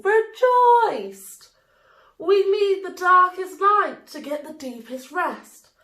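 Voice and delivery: joyful cheer as Night comes home. A narrator's reading. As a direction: ring out with celebration. People rejoice at Night's return.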